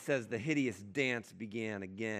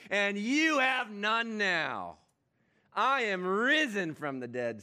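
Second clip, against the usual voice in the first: louder, proclaiming victory; triumphant Jesus; 6 semitones higher, 7 dB louder than usual. Acted. Jesus tells Satan he has no hold, proclaiming boldly. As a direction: declare it like a risen king.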